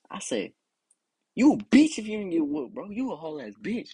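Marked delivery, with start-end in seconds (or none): 1.4-3.9 high, emphatic jeer; heated taunt. Acted. Speaker to anyone never whooped as a kid, high heated taunt. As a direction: taunt them high and forceful.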